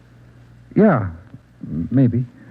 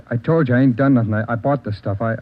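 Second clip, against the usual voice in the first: ordinary talk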